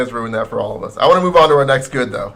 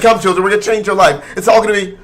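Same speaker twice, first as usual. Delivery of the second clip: higher, louder grand invitation; mock parent voice; 6 semitones higher, 5 dB louder than usual. Voicing the rich Dubai parents to the orphans, hammed-up. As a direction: beckon them like a sweet villain.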